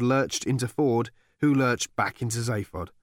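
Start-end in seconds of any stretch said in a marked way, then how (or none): none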